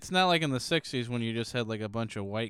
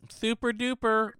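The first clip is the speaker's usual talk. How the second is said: silly high voice; 10 semitones higher, 3 dB louder than usual. Acted. Mocking the name.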